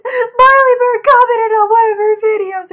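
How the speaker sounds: very loud, high fangirl holler; mock excited yell. Cousin announcing the comment to husband, mock excited yell. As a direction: re-enact yelling huge news across the house.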